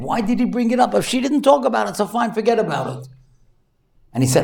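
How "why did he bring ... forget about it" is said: high-pitched regretful outburst; retold self-reproach. Therapist kicking himself over the girl's tears, high-pitched regret. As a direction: fret in a high voice at having brought it up. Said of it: "he felt so bad".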